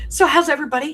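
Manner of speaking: high, loud call to the viewers; loud cheery greeting. Real, not acted. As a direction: greet everyone with a big lift. Calling out to viewers.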